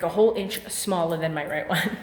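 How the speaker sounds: ordinary delivery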